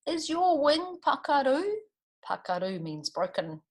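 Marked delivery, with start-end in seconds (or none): 0.1-1.6 lightly voiced worried little kiwi, higher; a small bird asking with concern; Ka Pai voice. A narrator's reading.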